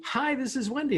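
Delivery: high, sing-out phone greeting; Wendy answering. Retold or imitated. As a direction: imitate a sister-in-law's perky pickup. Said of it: "if I called her, it would be".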